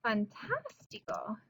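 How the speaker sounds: "Fantástico" slowly, leaning on the "tás"; stress on third-from-last syllable. Shown as a demonstration. Teacher demonstrating the tilde, "Fantástico" stressed on "tás". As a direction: softly draw out the "tás" of "Fantástico". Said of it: "Stress is on the third from last syllable".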